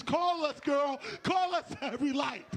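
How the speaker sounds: high, loud yelling; shouted girlfriend plea. Retold or imitated. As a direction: yell the friends' warning back in your head. Recalling the friends' plea.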